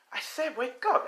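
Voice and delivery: high-pitched, insistent wake-up order; female voice. Acted. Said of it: "hears a female voice saying".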